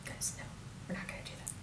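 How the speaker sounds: whispered, very soft reproach; hushed aside. Acted. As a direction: quietly shut the idea down. Hushed aside scolding the others.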